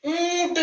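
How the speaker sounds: ऊंट high and soft, long ऊ through the nose; nasalised vowel. Shown as a demonstration. Teacher introduces the example word to children, nasalised vowel. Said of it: "ऊंट में भी नाक से स्वर निकल रहा है".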